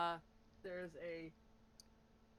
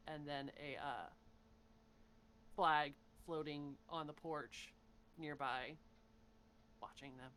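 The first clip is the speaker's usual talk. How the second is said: ordinary talk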